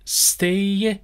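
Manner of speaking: normal talking voice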